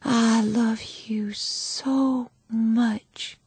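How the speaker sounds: slow, soft, barely voiced; exhausted mother whispering to her baby; tender whisper. A narrator's reading. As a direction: cradle the words to the newborn.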